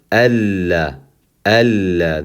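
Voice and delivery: alla with a held double l; doubled lam. Shown as a demonstration. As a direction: linger on the ll in alla. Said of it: "The shadda again makes it two lams".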